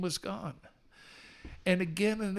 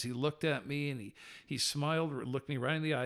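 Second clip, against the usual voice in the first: usual speaking voice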